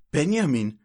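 loud, high croaked name; croaking. Acted. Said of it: "krächzte sie". (krächzend)

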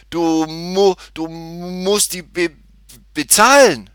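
high, slow stutter bursting into the last word; loud stammer. A narrator's reading. Cashier demands payment from the girl, agitated stammer. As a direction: get stuck on syllables, then blurt it out loudly. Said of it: "stotterte er".

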